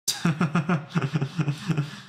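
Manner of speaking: real chuckling run; laughing. Real, not acted. Streamer laughing at turning green, amused laughter. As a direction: laugh in a long string of ha-has.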